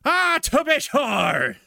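put-on slow, high, shouted stage Irish accent. Comedian put on the spot shouting a stage Irish line. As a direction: belt out a cartoon Irish accent. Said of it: "if you could do Irish, do it".

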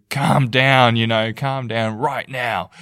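loud, mock angry order; imitating an angry parent snapping calm down. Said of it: "saying it in a really angry way".

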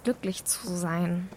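usual speaking voice

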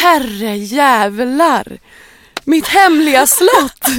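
drawn-out disbelief at finding the castle; awestruck gasp. Retold or imitated. Teen rediscovering her childhood castle, stunned and slow.

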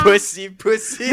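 high, loud repeated jeer; hammed-up jeering; mock taunting. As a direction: chant it as a teasing heckle. Acting out the final taunt.

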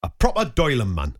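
put-on Newcastle accent; imitated Geordie phrase. Shown as a demonstration. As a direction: do it in a North East English accent. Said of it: "do you know what accent I'm doing".

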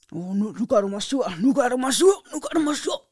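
rising irritation. Retold or imitated. Woman's mood flips to angry.

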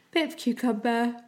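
words stretched by a yawn; yawning mid-phrase; through a yawn. Real, not acted. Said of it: "I'm yawning already".

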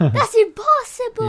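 high, loud cry of disbelief; astonished disbelief. Real, not acted. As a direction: burst out in real amazement. Amazed at how old the comic is.

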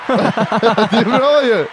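high, loud, very slow laughing "Det är bra ju"; "Det är bra ju" stretched high and slow; mock praise.

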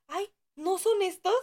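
high, slow, mock-surprised; fake innocence. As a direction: act like they just found them. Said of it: "fue como de".

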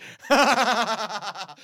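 real laugh bursting out; big laugh. Real, not acted. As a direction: crack up at the own punchline. Laughs at own crude joke.

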